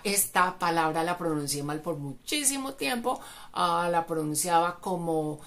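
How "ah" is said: normal talking voice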